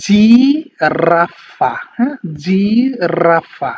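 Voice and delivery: জিরাফা drawn out very slowly, "jee-ra-fa"; slow Italian pronunciation. Shown as a demonstration. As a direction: stretch জিরাফা syllable by syllable with an "ee" sound. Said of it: "এই ই সাউন্ডের দিকে চলে যাবে".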